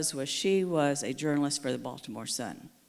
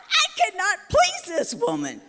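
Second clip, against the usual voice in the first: very high, louder exasperated wail; 8 dB louder than usual. Retold or imitated. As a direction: throw up your hands vocally. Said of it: "I went".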